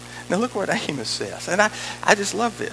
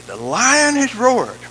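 slow, loud proclamation; declaimed scripture; 3 semitones higher, 7 dB louder, slower than usual. Acted. Preacher urges church not to be ashamed, declaiming. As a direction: stretch the verse out with force.

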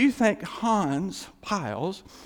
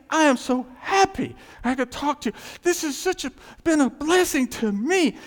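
happy stranger; 9 semitones higher, 4 dB louder than usual. Retold or imitated. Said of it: "He said".

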